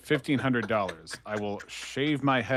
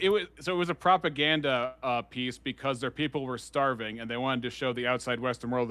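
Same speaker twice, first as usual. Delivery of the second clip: usual speaking voice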